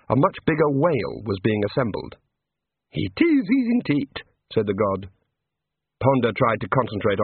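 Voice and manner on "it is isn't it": high, bright small-god voice; cheerful little god. A narrator's reading. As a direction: play an eager little craftsman god. God character voice.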